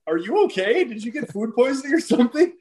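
mock alarm. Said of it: "And I'm like".

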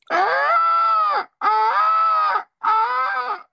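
very high, loud crying screech; peacock call. Retold or imitated. Peacock screams by the river at dusk, very high loud wail. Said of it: "I heard that".

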